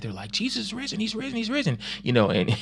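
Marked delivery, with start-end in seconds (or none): 0.3-1.8 high, giddy chant of he's risen; excited. Retold or imitated. Disciples rushing to tell Thomas, high and giddy. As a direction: sing it out like thrilled disciples. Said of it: "they're excited".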